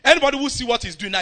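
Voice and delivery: normal talking voice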